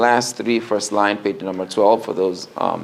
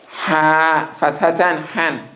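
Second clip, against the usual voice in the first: ha said higher, breathy from mid throat; high pitched ha; 4 semitones higher than usual. Shown as a demonstration. Teacher shows the high-pitched ha to the class. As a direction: lift the pitch on every ha. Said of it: "the ha is high pitched".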